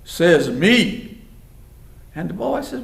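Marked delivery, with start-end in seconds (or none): 0.0-1.2 loud, lightly gravelly comeback; a touch of gravel, standing firm; tough, gravel-edged retort. Acted. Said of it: "he says in this gravelly voice".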